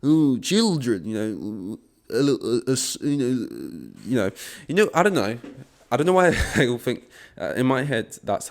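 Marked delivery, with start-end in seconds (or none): 0.0-4.9 the creep's inner voice, slowed and leering; creepy imagined thoughts. Retold or imitated. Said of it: "he thinks".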